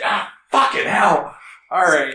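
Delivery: high yell of someone just shot; pained outcry. Acted. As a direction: cry out as the arrow hits. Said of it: "and he just".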